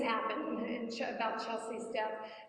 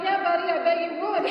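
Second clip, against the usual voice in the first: loud, knowing sarcasm; sarcastic; 5 semitones higher, 9 dB louder than usual. Retold or imitated. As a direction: scoff at his offer, loud. Suspicious reply to the therapist's offer.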